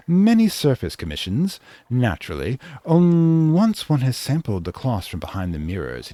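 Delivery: high, loud and theatrical society lady; haughty fashion villain, high and loud; flamboyant couturière. Acted. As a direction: declaim it like a grand dressmaker. Voices the Modisti's grand speech.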